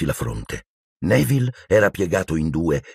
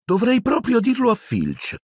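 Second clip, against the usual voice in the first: much higher, sweetly innocent; serene poltergeist. A narrator's reading. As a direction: fake angelic threat. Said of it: "con voce serafica". (tono serafico e falsamente innocente)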